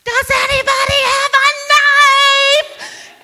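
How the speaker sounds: high, loud, slow sung-out call; yelled. Retold or imitated. Yelling across the lake for a knife, loud sung call. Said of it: "So I yelled".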